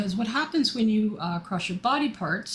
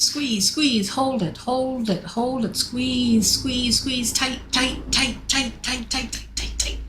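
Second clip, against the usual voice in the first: higher, rapid-fire repeated urging; insistent, higher-pitched chant of encouragement; urgent coaching; 4 semitones higher, slower than usual. Real, not acted. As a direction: egg them on to hold tight. Urging group through a squeeze.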